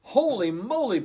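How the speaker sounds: high, loud startled shout. Acted. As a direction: shout it out in alarm. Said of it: "Puddle shouted".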